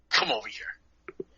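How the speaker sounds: slow, hushed whisper; whispered summons. Retold or imitated. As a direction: beckon him in a low hiss. Teacher summons quietly.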